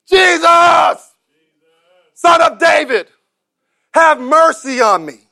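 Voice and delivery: high, loud shout for mercy, no restraint; undignified cry. Acted. Blind man shouts to Jesus over the hushing crowd, loud and undignified. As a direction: cry out with no dignity at all. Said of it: "he cried out in an undignified way".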